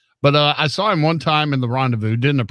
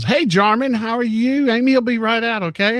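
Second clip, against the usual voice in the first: high, chirpy greeting imitating a salon girl; perky receptionist; 11 semitones higher than usual. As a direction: mimic a receptionist greeting a client, pitched way up. Acting out the salon girl's greeting.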